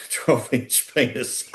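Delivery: slow, laughing through the last words; real laughter breaking into the punchline. Real, not acted. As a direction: crack up while landing the joke.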